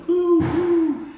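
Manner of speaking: put-on high, loud excited kids calling out. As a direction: shout it up high like thrilled little kids.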